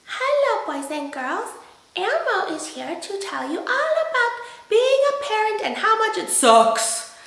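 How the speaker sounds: high squeaky Elmo voice, laughing. Retold or imitated. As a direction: do a giggly Elmo. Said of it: "It's my Elmo impression".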